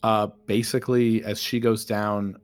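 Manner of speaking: normal talking voice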